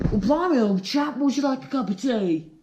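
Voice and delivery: high, stiff English gentleman; posh chap voice. Acted. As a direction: play the polite Brit offering tea. Sketch: posh Brit offers tea.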